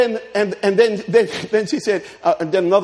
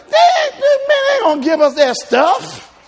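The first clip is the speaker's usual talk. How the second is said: very high, loud, slow protest; mock outraged men; 6 dB louder, slower than usual. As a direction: squawk like indignant husbands. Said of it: "Men like".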